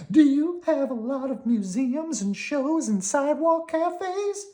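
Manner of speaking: high squeaky worm; high, cheerful little worm. Acted. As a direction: pitch it up like a tiny worm.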